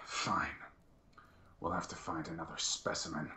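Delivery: low, hushed resignation; soft, deflated acceptance; resigned. Acted. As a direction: give up grudgingly, almost under your breath. Inquisitor resigns himself after failure.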